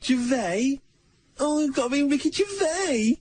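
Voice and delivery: ordinary delivery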